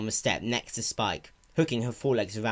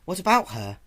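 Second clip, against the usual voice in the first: put-on young dragon voice; 8 semitones higher, 4 dB louder than usual. Spike voiced in story.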